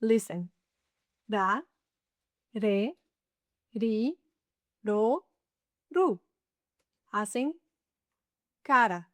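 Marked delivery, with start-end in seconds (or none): 1.3-6.2 DA, RE, RI, RO, RU with a single light tap, never rolled; spaced syllables with a soft tapped R; soft R drill. Shown as a demonstration. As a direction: recite "Da, re, ri, ro" rising, "ru" falling. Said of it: "the soft R sound".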